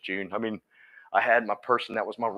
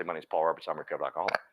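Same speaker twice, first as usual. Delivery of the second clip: softer, lower stage line said as a private hope; quiet earnest confession; 8 semitones lower, 10 dB quieter, faster than usual. Retold or imitated. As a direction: say it hushed, like a dream you hold close. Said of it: "I wanna stay on stage and say".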